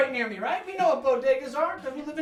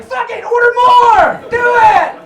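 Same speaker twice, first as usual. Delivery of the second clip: high, loud hyped-up yell from the back; excited yell; 8 semitones higher, 10 dB louder than usual. Retold or imitated. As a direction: shout it like a giddy stock boy. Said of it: "Just a guy in the back like".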